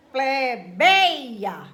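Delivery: "Plebeia" with "bei" loud and long; stressed syllable. Shown as a demonstration. Teacher showing a paroxytone, "Plebeia" loud and stretched on "bei". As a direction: push hard on the middle of "Plebeia". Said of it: "como é uma paroxítona".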